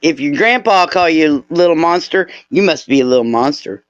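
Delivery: ordinary delivery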